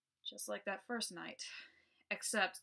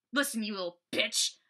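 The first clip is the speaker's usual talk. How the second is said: louder menacing snarl; angry growl; 2 semitones higher, 8 dB louder than usual. Acted. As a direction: growl it down at her. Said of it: "I growled angrily".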